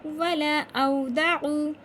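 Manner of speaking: slow, loud chanted "वला औदउ"; recitation demo. Shown as a demonstration. Teacher showing the right reading, slow loud chant. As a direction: recite it firmly with the last vowel held. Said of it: "कैसे पढ़ेंगे?".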